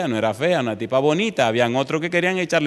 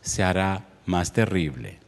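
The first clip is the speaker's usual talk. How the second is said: solemn warning; 6 semitones lower, 3 dB quieter, slower than usual. Acted. Slow grave sermon warning.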